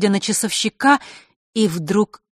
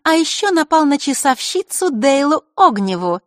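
put-on higher, cool accusing girl; collected higher girlish tone; calm girl's voice; 9 semitones higher, 6 dB louder than usual. A narrator's reading. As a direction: list the charges coolly. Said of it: "спокойно сказала Маришка".

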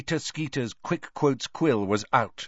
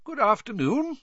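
high-pitched, genial greeting; pleasant; 13 semitones higher than usual. A narrator's reading. Dumbledore greeting the students at the door, pleasant. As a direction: greet them kindly, smiling. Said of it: "he said pleasantly".

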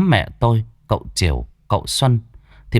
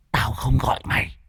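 soft, cold and curt, a touch higher; a possessed boy coldly rebuffs, quieter; cold spirit voice; 4 semitones higher, 5 dB quieter than usual. A narrator's reading. Possessed son coldly rebuffs his mother, soft and curt. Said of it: "ánh mắt lạnh lùng mà đáp gọn lọt".

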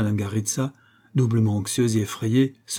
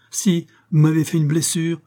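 higher, heated reproach; insistent accusation; 6 semitones higher, 4 dB louder than usual. A narrator's reading. Wounded woman contradicts the master, insistent accusation. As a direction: insist against the denial, pitch raised.

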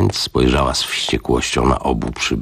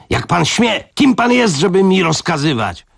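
much higher, indignant outburst; furious outrage; 14 semitones higher than usual. Acted. Matron outraged at the old man's orders, shrill fury. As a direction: shriek it as if insulted.

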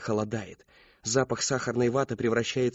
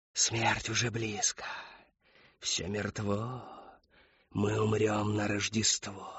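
soft, slow, hushed doom; feed her the words in an eerie murmur; quiet prompting; 3 semitones lower, 7 dB quieter, slower than usual. A narrator's reading. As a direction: Whisperer prompts the old woman, softly and slowly. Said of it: "подсказала Шептунья".

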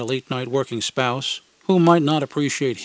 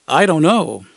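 waffling; 3 semitones higher, 6 dB louder than usual. Retold or imitated. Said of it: "I waffled".

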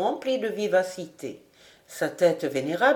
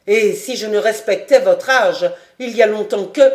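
furious; 8 dB louder than usual. A narrator's reading. Furious baron speaks.

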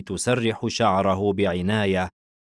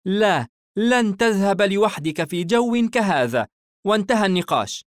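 high, louder, firm refusal; stern mother; 11 semitones higher, 4 dB louder than usual. A narrator's reading. Mother forbidding her son to go alone, stern. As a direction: lay down the law as the mother. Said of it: "الأم بنبرة صارمة".

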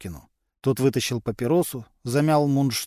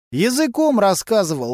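snorted; 7 semitones higher, 4 dB louder than usual. A narrator's reading. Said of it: "фыркнул Василий".

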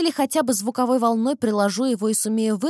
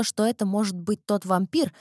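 plain reading voice

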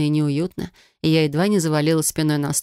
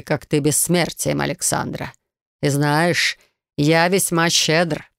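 commanding snake lord. Acted. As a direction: lay down the law like a serpent king. Snake lord persuades the heroine.

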